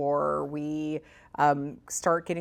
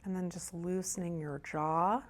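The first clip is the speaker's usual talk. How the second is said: soft, hushed meditation guide with long pauses; guided meditation; 9 dB quieter than usual. Real, not acted. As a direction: ease them gently into breath and body, softly. Leads a meditation.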